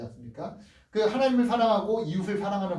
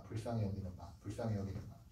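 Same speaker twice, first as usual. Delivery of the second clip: much lower and much softer, almost breathed; hushed repetition; 11 semitones lower, 16 dB quieter, faster than usual. Shown as a demonstration. Preacher softly repeating the key phrase to the congregation. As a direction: let the phrase sink in quietly.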